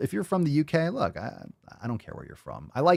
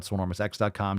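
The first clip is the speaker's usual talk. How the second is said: ordinary delivery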